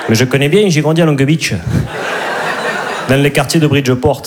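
put-on high, laughing Midi accent in a New York romcom; southern French accent. Comic imagining a New York romcom dubbed with the Midi accent, high laughing Midi accent. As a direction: play a Marseille-accented New Yorker, giggling. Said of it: "une comédie romantique à New York avec l'accent du Midi".

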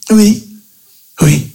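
a breathy high yes from the wife; wife's voice. Acted.

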